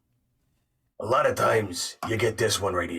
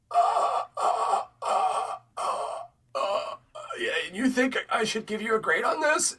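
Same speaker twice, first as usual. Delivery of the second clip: flustered teacher; 9 semitones higher than usual. Retold or imitated. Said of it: "this teacher's just like".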